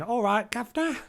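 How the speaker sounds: a loud, high, hammed-up Cockney accent; mock Cockney. Teasing her co-host by mimicking her sudden Cockney, hammed-up Cockney accent. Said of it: "you just went".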